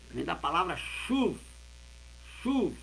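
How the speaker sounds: ordinary talk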